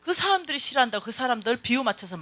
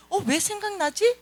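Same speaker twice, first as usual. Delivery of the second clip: high, drawn-out puzzled question; questioning wonder; 11 semitones higher, slower than usual. Shown as a demonstration. Preacher models questioning a sudden thought, wondering. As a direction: ask it slowly, pitched high in puzzlement. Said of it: "생각났을 때는 질문해야 돼".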